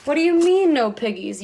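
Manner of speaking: high, loud witch screech. Acted. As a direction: shriek at the chicken. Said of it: "Gritch screeched".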